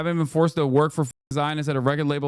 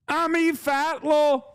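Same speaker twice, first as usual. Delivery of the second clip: much higher, slow put-on Middle Eastern accent with a laugh; mock Middle Eastern accent; 10 semitones higher, slower than usual. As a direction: break into a laugh mid-parody, accent on thick. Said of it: "organic Middle Eastern accent".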